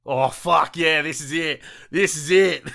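loud, high hype yell; excited cheering. Real, not acted. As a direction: celebrate like you just won. Hyped about big score.

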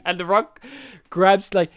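ordinary talk

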